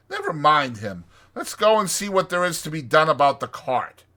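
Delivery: high, emphatic know-it-all Rat; firm. A narrator's reading. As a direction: explain it loudly, brooking no argument. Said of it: "replied the Rat firmly".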